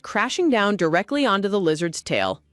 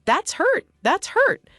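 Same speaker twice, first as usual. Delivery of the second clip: much higher, whiny mimicry of a cry; mocking pained cry; 12 semitones higher, slower than usual. A narrator's reading. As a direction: guests mocking her pain, shrill. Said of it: "cry out in pain".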